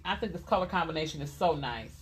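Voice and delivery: ordinary talk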